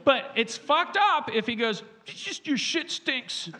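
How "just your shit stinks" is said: high, slow, soft whine; mock tearful. Imitating a weeping plumber to his customer, mock tearful. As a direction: squeeze it out as if crying. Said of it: "why are you crying".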